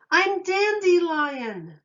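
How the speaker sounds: a slow, high, loud roar; offended lion roaring his name. Acted. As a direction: roar your own name at the shut door. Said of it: "he roared".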